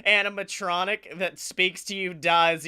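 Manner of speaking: usual speaking voice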